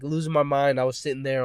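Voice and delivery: usual speaking voice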